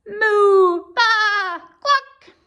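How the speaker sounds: high loud moo, baa and cluck; animal cries. Acted. Animals bursting out from under the farmer's bed. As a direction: burst out with farmyard noises.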